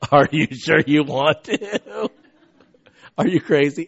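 laughing disbelief. Retold or imitated. Parents laughing at VP's offer.

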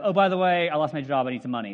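loud, breezy by-the-way; careless request. Retold or imitated. Andrew back from world tour asking casually for money. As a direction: toss it off casually. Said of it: "and he coming back and go".

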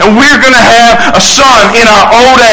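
triumphant preacher's re-enactment, rising in excitement; jubilant proclamation. Acted. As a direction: declare wonderful news to all the doubters. Acts out Zacharias's ideal joyful reaction.

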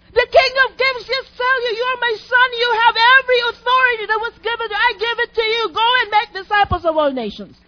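preacher rallying the church to courage; full-force preaching. Acted. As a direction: rouse the congregation.

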